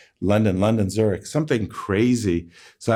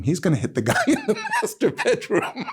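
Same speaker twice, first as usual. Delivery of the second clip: laughing punchline; 12 semitones higher than usual. Real, not acted.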